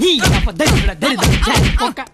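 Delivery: angry cursing. Acted. Son curses friend after teasing.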